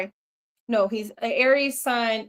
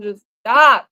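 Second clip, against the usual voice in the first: loud retort; 6 semitones higher, 12 dB louder, slower than usual. Retold or imitated. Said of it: "I told him".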